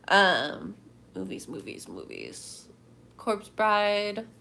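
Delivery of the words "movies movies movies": much lower, softer, sinking mock voice on movies; deep mock voice. Musing to chat in a silly low voice. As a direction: drop into a silly low drone.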